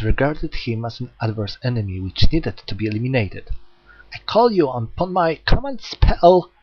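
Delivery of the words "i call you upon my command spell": high, loud desperate command; commanding invocation. Acted. Hyoma invoking his command spell, shouted. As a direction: cast the spell with all your force.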